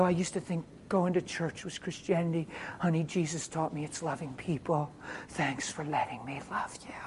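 very soft, intimate delivery; quiet, loving reassurance; tender sincerity. Retold or imitated. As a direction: confide gently, almost hushed by the end. Retells tender words to healed woman.

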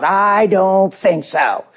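very slow, drawn-out put-down; imitated slow, scoffing rebuff; scornful dismissal. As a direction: stretch every word in disdain. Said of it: "She'd say".

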